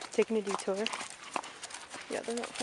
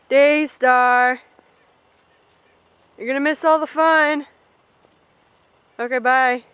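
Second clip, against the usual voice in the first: loud, higher call across the field; 4 semitones higher, 13 dB louder than usual. Real, not acted. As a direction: coax her to come down. Calling a far-off pony.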